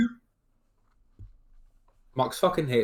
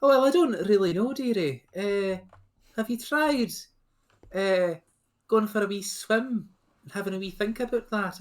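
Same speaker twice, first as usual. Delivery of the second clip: put-on higher-pitched Scottish accent, gently lilting advice; Scottish Nessie; 7 semitones higher than usual. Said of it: "it's got a Scottish accent".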